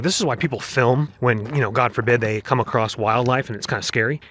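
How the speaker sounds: ordinary talk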